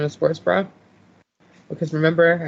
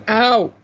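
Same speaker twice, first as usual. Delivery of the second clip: pained yelp; 8 semitones higher, 7 dB louder than usual. Real, not acted. Sudden pain mid-sentence.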